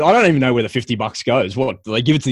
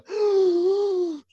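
sharp high gasp of welling tears; panicked gasp; 4 dB quieter than usual. Retold or imitated. Describing the anxiety attacks to his podcast host, a high panicked gasp. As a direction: suck in air as if about to sob. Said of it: "like that, you know".

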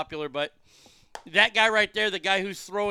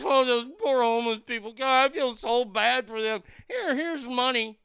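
higher, sing-song mock sympathy; mocking pity; 5 semitones higher than usual. Host mocking people who pity the homeless, sneering mock pity. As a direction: parody the bleeding hearts. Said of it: "We're still walking around going".

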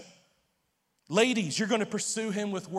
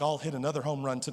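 suggestive joke; 7 semitones lower, 6 dB quieter, faster than usual. Retold or imitated. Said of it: "And I'm like".

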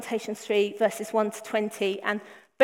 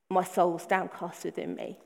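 quieter, sinking, mock-gloomy read; mock downcast; 5 semitones lower, 6 dB quieter than usual. Shown as a demonstration. Preacher reading the lament, mock downcast and hushed. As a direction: let the verse droop as if truly low. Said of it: "That's kind of an uber understatement".

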